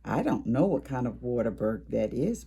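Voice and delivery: plain reading voice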